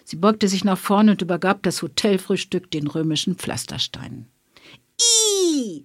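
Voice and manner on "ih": put-on a much higher, shrill-free yelp of disgust from a child; child's squeal. A narrator's reading. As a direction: squeal like a grossed-out kid. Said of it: "schrie ein Kind". (angeekelter Kinderquietscher)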